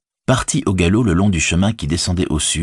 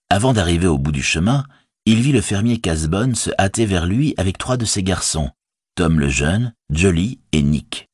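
plain reading voice

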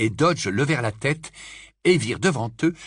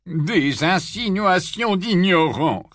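put-on slow, offended old gentleman; a lightly voiced old Dodge, slow and offended; stiff old wizard; 7 semitones higher, 4 dB louder, slower than usual. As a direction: drag out the retort with wounded dignity.

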